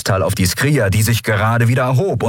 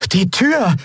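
higher, sudden realisation; boy's exclamation; 13 semitones higher than usual. A narrator's reading. Young Miko realises the door is open, exclaiming. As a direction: blurt it out as it dawns. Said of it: "rief Miko aus".

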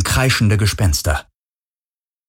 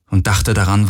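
plain reading voice

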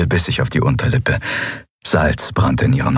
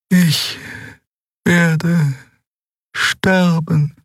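high, halting, each word apart; mild sorrow; 13 semitones higher, much slower than usual. Acted. Xea finally confessing to Endriel that she will die, halting. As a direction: admit it slowly, with effort.

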